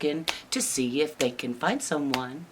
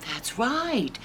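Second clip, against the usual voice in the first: usual speaking voice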